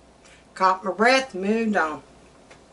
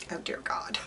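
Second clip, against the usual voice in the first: whispered; 16 dB quieter than usual. Real, not acted. Exasperated aside under breath.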